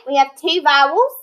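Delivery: ordinary talk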